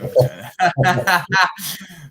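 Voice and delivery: real hearty laugh, no words; a loud, real burst of laughter. Real, not acted. Laughing mid story at interviewer.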